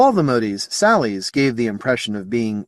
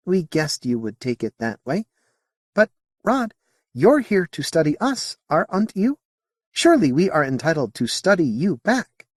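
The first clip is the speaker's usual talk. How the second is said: high, lilting alien voice; a curious alien, politely puzzled; alien Modi voice; 7 semitones higher than usual. Acted. Alien answering Sally's question in a high voice.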